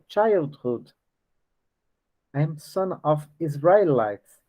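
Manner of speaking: ordinary talk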